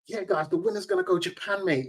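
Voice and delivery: put-on Cockney accent hyping the prize trip; imitating a Cockney accent.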